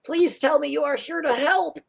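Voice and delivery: high anxious gnome voice; high nervous tinkerer; pleading little gnome. Acted. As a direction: beg the heroes for help in a small high voice. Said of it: "the gnome says".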